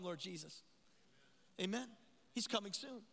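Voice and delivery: usual speaking voice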